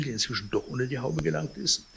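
plain reading voice

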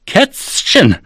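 high, outraged echo of the insult; indignant. A narrator's reading. Offended theater cat repeats the director's name for him, indignant. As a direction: repeat it high-pitched, falling away in outrage. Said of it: "Ich war empört".

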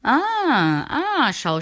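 high, eager call across a distance; calling from afar. A narrator's reading. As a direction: hail the visitor from far off, excited. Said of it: "rief der Eitle von weitem".

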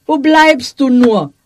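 slow, called from another room; calling out. A narrator's reading. Dietrich calls for his wife from the next room. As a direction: call out impatiently to her. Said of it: "rief Dietrich".

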